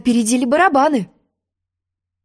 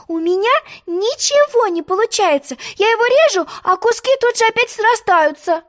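put-on a high, puzzled girl; puzzled Alice; 11 semitones higher than usual. A narrator's reading. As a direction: complain in a girl's voice. Alice explaining.

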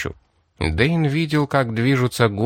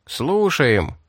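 usual speaking voice

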